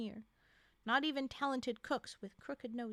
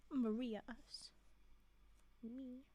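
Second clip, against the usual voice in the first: hushed whispered correction; soft whispered aside; 8 dB quieter, slower than usual. Real, not acted. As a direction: breathe it as an aside. Correcting herself under her breath.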